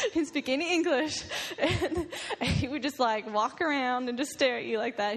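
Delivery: giggling through the words; laughing. Real, not acted. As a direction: talk while laughing, high and breathy. Laughing describing the odd pastor.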